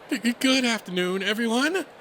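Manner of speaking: high, stammering nervous rabbit voice; jittery, high-pitched welcome; timid announcer. Acted. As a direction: stumble nervously into a public greeting. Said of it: "The timid little thing".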